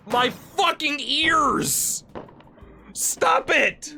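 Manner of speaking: high, drawn-out cry of pain at the noise; pained outcry. Real, not acted. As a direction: yelp about your ears. Reacting to loud noise.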